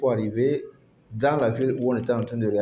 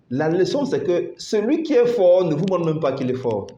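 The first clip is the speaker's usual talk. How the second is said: raised, insistent moral; emphatic preaching; 5 semitones higher, 5 dB louder than usual. Acted. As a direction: hammer home the lesson. Punchline of lesson.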